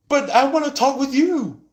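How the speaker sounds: very high, loud whine; whiny intruder. Retold or imitated. Needy third party whining for attention, very high.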